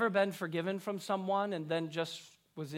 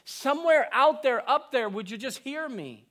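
calling out to distant God; 6 semitones higher, 7 dB louder than usual. Retold or imitated. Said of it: "and we think".

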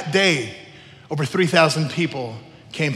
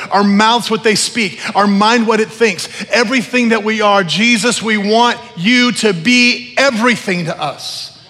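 loud, raised, driving sermon voice; fervent, loud call to surrender; impassioned preaching; 5 semitones higher, 7 dB louder than usual. Real, not acted. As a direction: preach it at full force, phrase by phrase. Preaches at full force.

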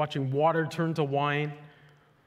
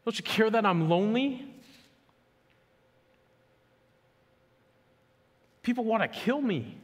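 higher pleading cry to Jesus; desperate plea; 6 semitones higher than usual. Retold or imitated. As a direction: pray it like someone at the end of their rope. Voicing people's desperate prayers.

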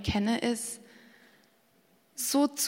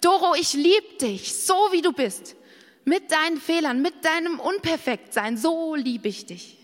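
tender loving voice; 5 semitones higher, 3 dB louder than usual. Pretend. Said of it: "Sondern er sagt".

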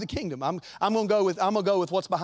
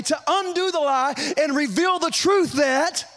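preaching at full force; 7 semitones higher than usual. Acted. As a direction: drive it home to the congregation. Preaching build-up.

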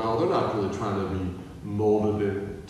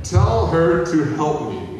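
demanding Martha; 4 semitones higher, 7 dB louder, slower than usual. Acted. Martha complaining to Jesus.

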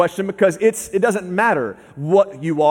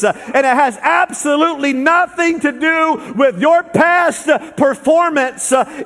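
higher, rising preacher's fervour; building sermon; 6 semitones higher, 3 dB louder than usual. Acted. Preacher rousing the congregation, building fervour. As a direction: lift them with growing conviction.

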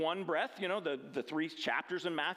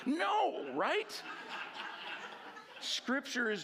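laughing; 9 semitones higher, much slower than usual. Real, not acted. Laughs at the witch example.

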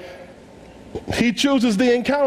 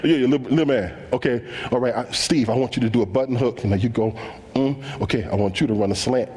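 put-on much lower, humouring the kid; low patronizing quarterback; 9 semitones lower, faster than usual. Quarterback humouring the little kid in street football, low voice. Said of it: "So you say".

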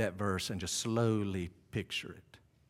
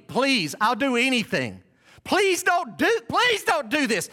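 high, loud, acted begging; desperate pleading; 14 semitones higher, 8 dB louder, slower than usual. Bound man begging rowers not to drown him, desperate pleading. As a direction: beg for your life, climbing higher. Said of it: "He is begging and pleading".

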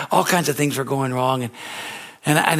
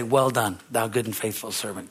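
lower, soft and tender; quiet warm voice of God blessing; God's gentle praise; 4 semitones lower, 6 dB quieter than usual. Acted. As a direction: speak as God commending a servant. God's words quoted.

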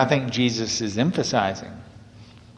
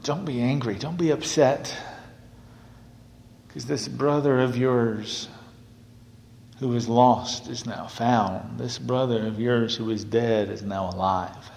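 gentle consoling; 6 dB quieter than usual. Acted. Father consoles the older son.

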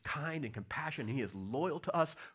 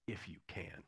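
quiet voice of God; 9 semitones lower, 12 dB quieter than usual. Retold or imitated. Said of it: "God says".

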